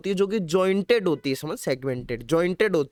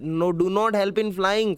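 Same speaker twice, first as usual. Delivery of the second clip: slow, word by word dictation; dictating slowly to students; much slower than usual. Shown as a demonstration. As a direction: spell it out slowly for notes. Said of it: "ओके".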